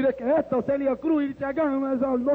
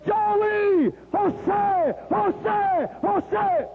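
high, drawn-out repeated calls; imitated calling shout; 7 semitones higher, much slower than usual. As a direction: holler each name as if across a workplace. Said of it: "Él venía gritando".

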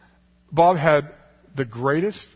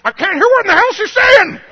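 high, loud yell through laughter; loud complaint; 14 dB louder than usual. Retold or imitated. Deaf Bob yelling in church that he can't hear, laughing. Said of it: "he gets louder and louder".

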